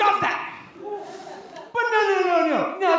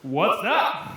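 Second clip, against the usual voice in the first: low, deep official question; border guard; 10 semitones lower than usual. Acted. Border guard to driver about powder, low and suspicious.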